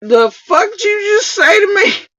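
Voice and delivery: high-pitched, offended squawk; pissed-off deer. Acted. Offended deer confronts the woman who mooed, hammed-up high outrage. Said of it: "so pissed off at Little".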